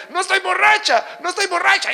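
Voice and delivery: high-pitched mock protest; imitated giggly sister. Church sister denying she is drunk between laughs, imitated high protest. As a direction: plead it high, imitating a woman caught in giggles. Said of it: "cuando lograba detenerse un poco decía".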